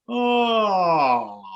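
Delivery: yawned oh sliding down; yawning glissando. Shown as a demonstration. Teacher demonstrating a yawn glide. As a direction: open wide and yawn the sound. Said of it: "el bostezo".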